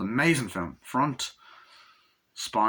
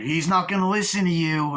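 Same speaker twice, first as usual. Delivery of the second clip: higher, louder retold objection; acted raised-voice argument; heated protest; 6 semitones higher, 5 dB louder than usual. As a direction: protest it as if mid-standoff. Acting out a pleading standoff.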